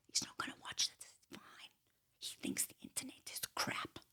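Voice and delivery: hushed gossiping whisper; conspiratorial whisper. Real, not acted. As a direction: lean in and whisper the secret aside. Confiding aside about psychiatrist.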